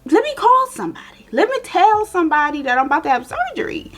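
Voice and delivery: high, nervous, rushing stammer; buy time, voice climbing with panic; panicked stalling. Retold or imitated. Patient stalling the nurse before surgery, panicky and high.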